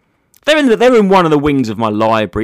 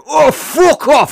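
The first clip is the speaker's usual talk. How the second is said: much higher, loud, slow mock crowd yell; 6 dB louder, much slower than usual. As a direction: bellow it like a whole room turning on her. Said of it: "oh, the crowd".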